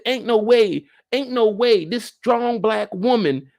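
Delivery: high, loud, scandalized protest; emphatic disbelief. Acted. Commentator refuses to believe she falls for it, scandalized. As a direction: protest it in mock outrage.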